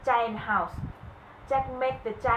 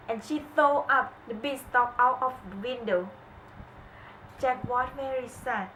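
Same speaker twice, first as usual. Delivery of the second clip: plain reading voice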